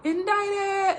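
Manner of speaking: Indicted squealed high in mock panic; mock panicked Indicted. Daughter busting out the joke, mock high panic. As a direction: copy the movie's frantic cry. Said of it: "she just bust out".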